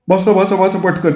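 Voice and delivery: hurried high urging; husband hurrying wife onto tractor; urgent hurry. Acted. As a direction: rush her aboard.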